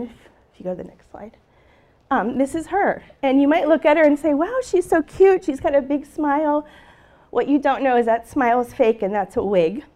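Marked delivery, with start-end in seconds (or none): 4.3-6.7 gushing admiration. Acted. Onlooker admiring a little girl's photo, gushing. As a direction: imitate a charmed onlooker, drawing out the wow.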